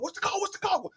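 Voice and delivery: urgent. Retold or imitated. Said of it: "hear his voice and his intensity".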